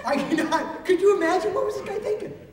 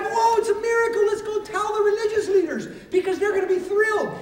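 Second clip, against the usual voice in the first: excited crowd; 6 semitones higher, 3 dB louder than usual. Acted. Townspeople react excitedly to miracle.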